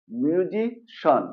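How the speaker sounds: "মিউজিশন" slowly, ending in one syllable shon; correct SHON ending. Shown as a demonstration. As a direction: stretch "মিউজিশন" as myu-zi, then shon. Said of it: "recap".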